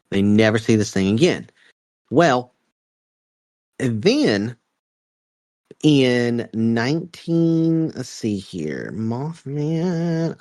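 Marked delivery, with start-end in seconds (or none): 2.1-10.4 drawn-out words while searching notes; stalling for time. Real, not acted. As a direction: stretch it slowly as you hunt for the fact. Stalling while checking his notes.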